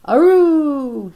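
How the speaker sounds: high, loud imitated puppy bark; dog bark. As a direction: yap like an excited puppy. Puppy barking during the prayer.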